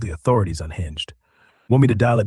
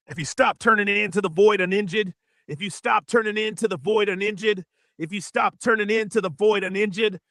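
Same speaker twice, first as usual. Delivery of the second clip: high glitchy loop; 8 semitones higher than usual. Acted.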